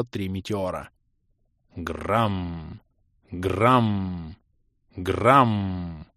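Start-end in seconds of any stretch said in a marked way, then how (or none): 1.8-6.1 low, heavy boom repeated; booming impact noise. A narrator's reading. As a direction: voice the crash like thunder. Meteors crashing into the port.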